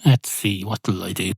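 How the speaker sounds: usual speaking voice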